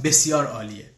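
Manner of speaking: ordinary talk, nothing marked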